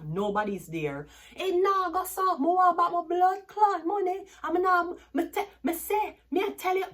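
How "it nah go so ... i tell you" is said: imitating a furious Jamaican cusser, much higher and louder; street cussing. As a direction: shout out the grievance to nobody there.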